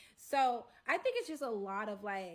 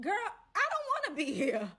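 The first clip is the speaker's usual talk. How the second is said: high, loud plea of exasperation; exasperated protest; 8 semitones higher, 7 dB louder than usual. Retold or imitated. Storyteller answering the side girl back, exasperated protest. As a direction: protest it, pitched well up.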